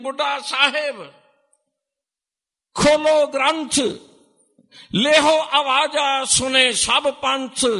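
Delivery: high, loud command, the last phrase slow; loud call. Retold or imitated. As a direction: announce it so the whole Panth hears. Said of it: "ਉੱਚੀ ਆਵਾਜ਼ 'ਚ ਬੋਲੇ". (ਉੱਚੀ ਆਵਾਜ਼ ਵਿੱਚ ਹੁਕਮ)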